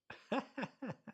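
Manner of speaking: high, soft burst of real laughter; real giggle over the photo. Real, not acted. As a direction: laugh out at the memory.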